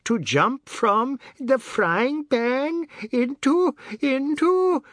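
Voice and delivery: very high, strained, drawn-out mirth; helpless squeal, struggling to finish; choking with laughter. A narrator's reading. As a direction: stretch words high as if laughter chokes them. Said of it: "choking with laughter".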